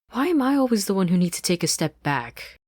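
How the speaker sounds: high, loud whiny complaint; complaining Anne. Acted. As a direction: protest to Marcy. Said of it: "Anne complained".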